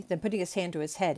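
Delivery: ordinary delivery, nothing marked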